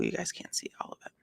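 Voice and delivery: fast whispered aside to the viewers; quick whisper. Real, not acted. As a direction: whisper it quickly, almost hidden.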